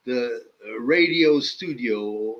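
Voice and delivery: usual speaking voice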